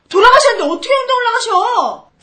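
high, loud exasperated outburst; angry disbelief. Acted. Mother angrily repeats with correction.

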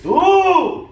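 high frustrated yell; loud yell. Real, not acted. Frustrated at partner during prank.

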